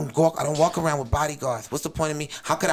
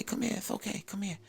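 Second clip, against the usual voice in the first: soothing hush; 5 semitones higher, 12 dB quieter than usual. Retold or imitated. Mike calms the gunman.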